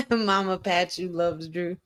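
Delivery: ordinary talk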